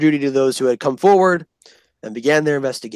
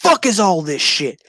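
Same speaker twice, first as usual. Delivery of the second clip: drill sergeant; 5 semitones higher, 4 dB louder than usual. Retold or imitated. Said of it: "Drill Sergeant Disney goes".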